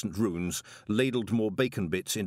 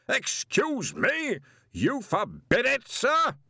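high, slow disbelieving echo; incredulous outrage; 14 semitones higher, 4 dB louder, much slower than usual. A narrator's reading. Arch-Chancellor echoes the intruder's command, slow and incredulous. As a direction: repeat his words in outraged disbelief. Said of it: "turning on Greenyham in sudden fury".

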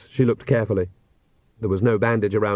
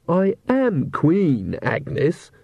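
slow, higher, reproachful reminder; 6 semitones higher, much slower than usual. A narrator's reading. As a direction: stretch it out in wounded dignity. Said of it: "said Magrat reproachfully".

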